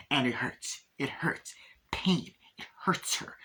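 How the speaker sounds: breathy hushed whisper, hammed up for effect; mock dramatic whisper. Mocking the film's metaphor, a hushed dramatic whisper. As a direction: whisper the pain like a melodrama parody.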